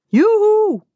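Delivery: high, loud, drawn-out cheer; whooping holler. A narrator's reading. Tobias cheers behind the skater, whooping holler. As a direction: let out a big yoo-hoo. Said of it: "brulde Tobias achter me".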